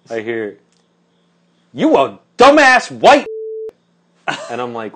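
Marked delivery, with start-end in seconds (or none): 1.7-3.3 high, loud mock tirade; imitated angry screaming. Angry woman at the gas station insulting the narrator, screaming. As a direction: hurl the insult at a high-pitched yell. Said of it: "like, screaming".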